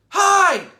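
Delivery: a loud, high greeting yell; a boy yelling hello across the kitchen. A narrator's reading. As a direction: shout it out bright and high. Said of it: "I yell".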